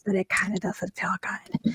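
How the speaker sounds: hoarse, near-whispered; raspy whisper. Real, not acted. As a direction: rasp it out under breath. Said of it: "so I'm not so raspy here".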